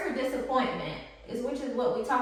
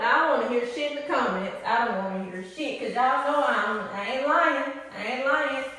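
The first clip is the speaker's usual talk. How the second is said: ordinary delivery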